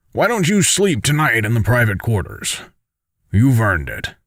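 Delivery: put-on voice for a considerate superior; Dex, caring commander. Commander sends tired soldier to rest, caring commander voice. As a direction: speak as the commander looking after a tired soldier.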